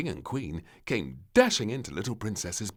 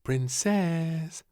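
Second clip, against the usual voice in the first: gentle wake; 10 semitones higher, 6 dB louder than usual. Acted. Queen waking sleeping child.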